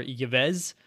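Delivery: Yves said as "yih-VEZ", in two syllables; mispronounced Yves. Shown as a demonstration. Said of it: "is not pronounced".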